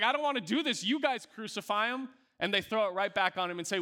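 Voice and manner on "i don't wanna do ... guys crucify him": high, pushing the blame away; Pilate, pitched up and unwilling; reluctant Pilate. Retold or imitated. Pilate refusing the crowd, a reluctant higher voice.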